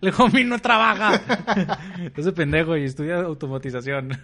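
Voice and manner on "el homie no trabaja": slow, loud laughing anchor; news announcer. Pretend. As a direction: read it like a headline while laughing. Mock news report, laughing.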